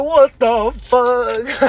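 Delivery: very slow, stretched-out, high disbelief; retold shock. Telling a friend about the green-light lurch, retold shock. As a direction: drag every word out in mock horror. Retelling shock at second take-off.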